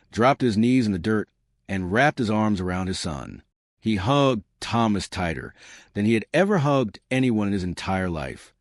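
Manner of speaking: ordinary delivery, nothing marked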